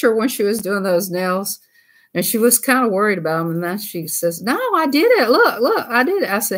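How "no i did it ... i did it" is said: high-pitched, insistent mock excitement; excited imitation. Woman showing off the nails she did, imitated in high excitement. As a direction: mimic an eager friend, pitched well up. Acting out friend proud of nails.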